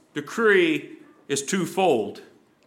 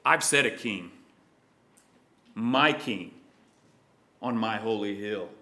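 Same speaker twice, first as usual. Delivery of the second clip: 1 quiet solemn decree; 8 semitones lower, 4 dB quieter than usual. Acted. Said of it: "in anger says".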